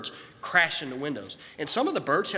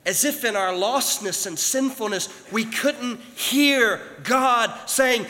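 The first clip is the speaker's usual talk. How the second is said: loud, high, stretched-out preaching; preaching at full force; 10 semitones higher, 8 dB louder, slower than usual. Acted. Preacher building to the climax for the church, full force. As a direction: drive the message home to the congregation.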